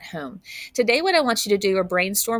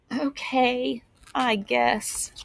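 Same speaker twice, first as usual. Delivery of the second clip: softer, grudging okay; reluctant; 4 semitones higher, 4 dB quieter, much slower than usual. Acted. Emilio grudgingly agreeing to Justin, softer and reluctant. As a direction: give in without wanting to.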